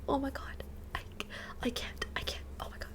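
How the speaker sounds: breathy whispered disbelief, voice dropping away; overwhelmed whisper. Retold or imitated. Retelling leaving her crying dog, whispered overwhelm. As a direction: whisper it like you can't bear to leave.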